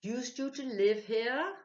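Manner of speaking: high, loud and slow; eager question. A narrator's reading. Ludovic quizzing the spirit, high and eager. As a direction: press the spirit, thrilled. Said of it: "asked Ludovic".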